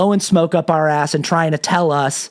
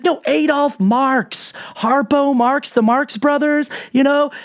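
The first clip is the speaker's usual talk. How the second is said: higher, drawn-out fake innocence; innocent excuse; 8 semitones higher, slower than usual. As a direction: act totally oblivious. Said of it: "And he's like".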